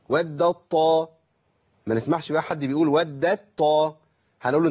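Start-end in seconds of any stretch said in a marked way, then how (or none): 0.1-1.1 ودت طا said very slowly, the ت fully merged into a stressed ط; very slow demonstration of the merged ط; slow tajweed demo. Shown as a demonstration. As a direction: drag out ودت طا, the t swallowed into a doubled ط. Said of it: "يبقى أقول إيه؟".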